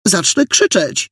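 high, slow, defiant warning; petulant threat. A narrator's reading. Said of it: "tupnęła Jola". (wysoka, uparta groźba)

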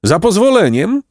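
high-pitched interruption; mild donkey roar. A narrator's reading. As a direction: butt in insistently as the donkey. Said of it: "ryknął Osioł po raz siódmy".

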